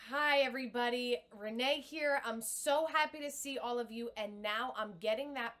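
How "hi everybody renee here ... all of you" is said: full breath-supported greeting, a little louder; confident on-the-breath hello; grounded, supported voice. Shown as a demonstration. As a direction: speak from the belly, steady and strong. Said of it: "So let me show you".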